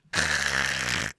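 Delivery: long, harsh guttural hiss; harsh throat rasp. Shown as a demonstration. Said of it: "Don't make it a harsh".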